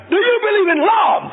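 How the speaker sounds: very high loud shout; third shout. A narrator's reading. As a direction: throw it out with full force. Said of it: "the preacher shouted a third time".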